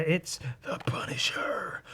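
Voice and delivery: slow breathless whisper, almost in awe; awed whisper. Acted. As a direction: breathe the name like a secret reveal. Awed reveal of the Punisher.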